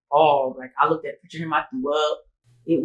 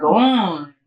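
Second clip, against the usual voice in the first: shocked disbelief; 7 dB louder than usual. Retold or imitated. Acted shock at the news.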